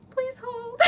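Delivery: high giggling through tears; laughing. Real, not acted. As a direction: laugh helplessly. Overwhelmed laughter.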